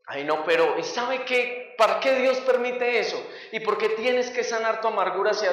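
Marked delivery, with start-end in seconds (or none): none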